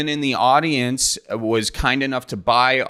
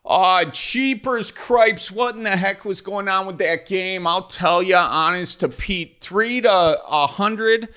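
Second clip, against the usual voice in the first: hammed-up Wisconsin dismay; 7 semitones higher, slower than usual. Mock outrage at Packers loss.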